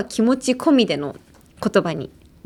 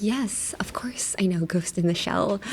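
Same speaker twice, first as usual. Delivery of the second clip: whisper; 8 dB quieter, slower than usual. Retold or imitated. Pretending to know the anime.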